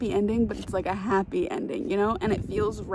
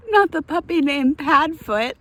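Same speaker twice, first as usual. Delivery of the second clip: loud protest; 6 semitones higher, 11 dB louder than usual. Real, not acted. Reading book aloud, reacting loudly.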